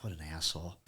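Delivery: soft, low mutter under the breath. Retold or imitated. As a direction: grumble it to yourself. Muttered reaction to friend's advice.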